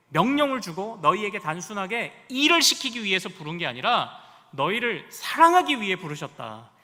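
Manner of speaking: ordinary talk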